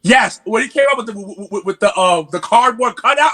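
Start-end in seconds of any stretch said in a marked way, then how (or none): none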